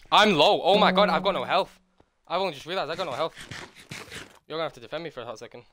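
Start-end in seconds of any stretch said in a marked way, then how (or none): 0.1-3.3 panicking gamer. Real, not acted. Low health in fight.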